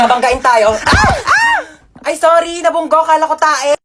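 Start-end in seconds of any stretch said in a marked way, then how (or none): 0.8-1.6 very high panicked shriek; crash scream. Acted. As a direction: scream as the car hits. (tili habang bumabangga)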